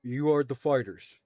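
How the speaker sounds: a loud, brusque guild official checking the party; loudly sizing up the fighters; guild clerk. Acted. Guild official questioning the adventurers, loud and brusque.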